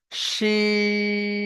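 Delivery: শিন held long with a hushing sh; drawn-out shin. Shown as a demonstration. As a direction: stretch শিন slowly for the class. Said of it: "এই অক্ষরটার নাম হলো". (লম্বা টানে শিন)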